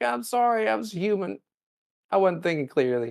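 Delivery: whiny mock apology. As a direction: grovel like a sorry kid. Spock apologising to T'Pring.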